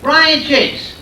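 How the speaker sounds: hammed-up Rhode Island hello; New England greeting. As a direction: bark it like a rough Providence local. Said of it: "it's like".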